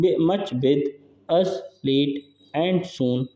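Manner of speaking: slow, separate beats on "much, with, us, late, and, soon"; very slow, measured scansion reading; syllable by syllable. Shown as a demonstration. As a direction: pause between the words to count syllables. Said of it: "एक इसमें हो गया syllable".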